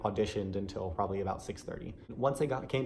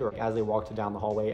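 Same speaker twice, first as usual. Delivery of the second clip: ordinary delivery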